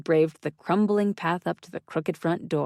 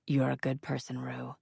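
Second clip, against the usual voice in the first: low, soft and intimate; soft murmur; 3 semitones lower, 7 dB quieter than usual. A narrator's reading. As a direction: murmur it close to her ear. Asa murmuring between kisses.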